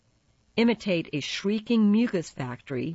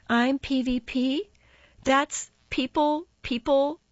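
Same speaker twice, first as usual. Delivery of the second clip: put-on high, drawn-out snippy teen queen; Faith's snippy voice; 10 semitones higher, 3 dB louder than usual. A narrator's reading. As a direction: say it high and slow, with snippy disdain. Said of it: "Faith says in her snippy voice".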